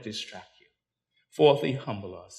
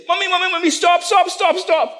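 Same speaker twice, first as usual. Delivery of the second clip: put-on much higher, urgent little child's cry; baby crying out; 9 dB louder than usual. Preacher voicing baby warning mother in car, high child's cry. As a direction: cry out like a tiny child begging mommy to stop. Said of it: "she couldn't speak, said".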